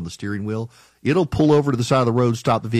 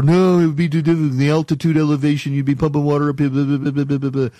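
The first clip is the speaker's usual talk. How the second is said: mock naysayer; 3 semitones higher, faster than usual. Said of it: "And people are".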